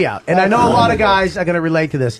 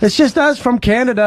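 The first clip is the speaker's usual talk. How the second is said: mock Canadian accent; 6 semitones higher than usual. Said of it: "I can hear your shitty accent".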